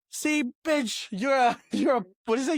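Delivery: high, teasing mock insult; mock taunt. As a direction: taunt him playfully, pitched well up. Comedian insulting mock-aggressively.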